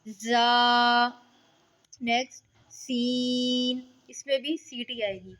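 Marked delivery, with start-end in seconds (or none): 0.1-1.1 जा with a clear whistle; hissing zaa. Shown as a demonstration. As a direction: let जा hiss. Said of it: "जा में हम सीटी लेके आएंगे". (जा सीटी के साथ)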